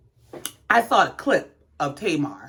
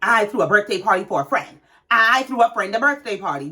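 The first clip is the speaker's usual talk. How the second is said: "I threw a birthday party for a friend" light, fast, then "I threw a friend a birthday party" pressed; mocking Tamar's emphatic tone; emphatic mimic; faster than usual. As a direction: parody her emphatic correction. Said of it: "without the emphatic".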